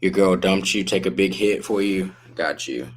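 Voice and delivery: usual speaking voice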